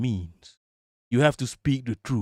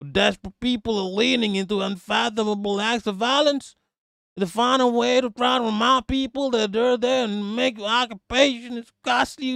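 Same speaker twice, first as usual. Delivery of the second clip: higher, mocking Yankee accent read of his statement; exaggerated Yankee accent parody, higher pitched; 7 semitones higher than usual. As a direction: sneer it out in a put-on Yankee accent. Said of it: "I'm gonna try to do a Yankee accent now".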